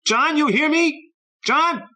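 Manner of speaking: put-on high, loud, slow furious boy's yelling; furious shout. A narrator's reading. Boy yelling at the friend who left, high furious shouting. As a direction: hurl it at the empty street, high and loud.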